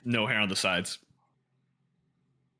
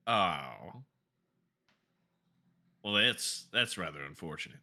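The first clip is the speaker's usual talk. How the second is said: underwhelmed Silas, a touch posh; lower, dry Silas; 6 semitones lower, 4 dB quieter than usual. Acted. Silas realizing he's been killed, dryly. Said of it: "looks at Velmira and goes".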